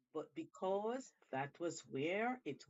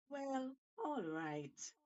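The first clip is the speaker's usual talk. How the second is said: reluctant monkey; 7 semitones higher, much slower than usual. Acted.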